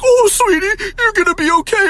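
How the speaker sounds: very high, loud sugary mom voice; cooing mom. Retold or imitated. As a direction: soothe the son with fake sweetness, up high. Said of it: "My mom's like".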